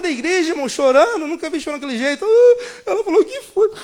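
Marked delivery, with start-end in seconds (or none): 2.8-3.7 laughing. Real, not acted. Laughing while retelling crying.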